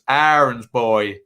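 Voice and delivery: drawn-out Norfolk vowels on Max Aarons, slow; slow, broad Norfolk accent saying Max Aarons. Retold or imitated. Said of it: "in Norfolk accent".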